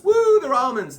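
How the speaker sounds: high, loud mock whoop; sarcastic over-the-top excitement; mock cheering. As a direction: cheer as if wildly amazed by a trifle.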